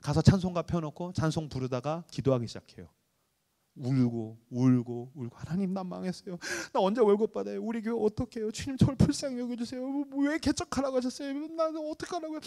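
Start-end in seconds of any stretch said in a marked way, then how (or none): 3.8-5.4 slow, lower, tearful drawn-out words; weeping. Retold or imitated. Pastor recalling nights crying alone in the church, slow and tearful. 5.4-12.4 rising, soft, choked plea; tearful prayer. Retold or imitated. Pastor reenacting his weeping prayer at night, choked and pleading.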